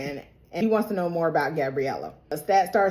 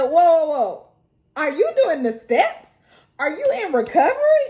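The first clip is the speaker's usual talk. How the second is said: higher and louder, climbing in disbelief; rising shocked questions, faster at the end; incredulous; 8 semitones higher, 4 dB louder than usual. Retold or imitated. Daniel stopping Armand before he reads the letter, loud, high and incredulous.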